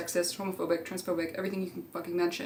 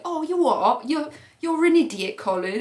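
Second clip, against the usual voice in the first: much higher, louder mock-scoffing friend; 8 semitones higher, 6 dB louder than usual. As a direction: scoff at him like a cheeky mate. Friend mocking Colin, acted quote.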